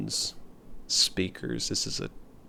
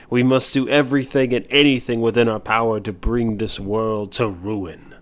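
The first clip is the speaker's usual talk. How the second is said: loud rallying dark preacher; villain sermon; 6 dB louder than usual. Acted. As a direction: rouse the faithful to ruin. Villain rallying his followers.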